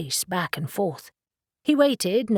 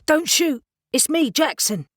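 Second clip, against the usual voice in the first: high, alarmed cry not to shoot; panicked plea; 8 semitones higher, 3 dB louder than usual. A narrator's reading. Jackson caught breaking in, pleading in alarm. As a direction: blurt it out in a fright.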